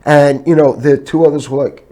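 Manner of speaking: usual speaking voice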